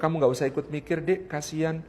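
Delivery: quietly spare her the worry; suami menenangkan. Acted. Suami menenangkan istri dalam cerita. (suara suami lembut dan rendah)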